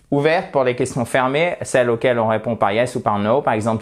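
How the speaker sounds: ordinary talk, nothing marked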